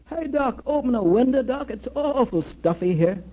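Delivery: high, strained call without real volume. Retold or imitated. Bandaged dying boy to the chaplain, calling out. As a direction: imitate a patient calling for the doctor. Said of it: "he called out loudly".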